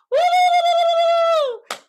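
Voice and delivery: high, loud whoop; excited cheer. Real, not acted. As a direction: let out a happy woo. Cheering thanks for donation.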